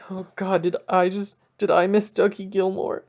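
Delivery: rising into a high, loud, cracking wail; hammed-up fan panic, building to a cry; panicking. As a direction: spiral from worry to a near sob. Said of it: "I'm freaking out internally".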